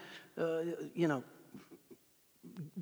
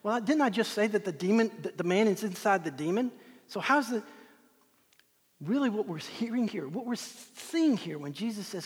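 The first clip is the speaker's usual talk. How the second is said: ordinary delivery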